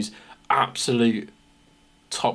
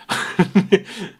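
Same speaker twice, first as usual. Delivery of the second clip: laugh; 8 semitones higher, 5 dB louder than usual. Real, not acted. Laughing at own joke.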